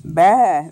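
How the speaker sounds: low bleat; sheep baa. Acted. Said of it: "that's the sheep".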